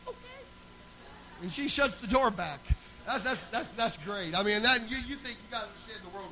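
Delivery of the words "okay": tiny high, soft imitation of a daughter; doubtful daughter. Daughter answering dad before shutting the door, tiny doubtful voice. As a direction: squeak it out very high and quietly.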